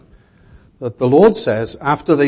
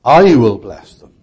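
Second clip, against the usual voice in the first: I hit much louder, the rest falling away; emphatic I; 9 dB louder than usual. Shown as a demonstration. Preacher showing who does the blessing, emphatic I. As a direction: punch the word I hard, then let it drop. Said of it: "I" there is emphatic".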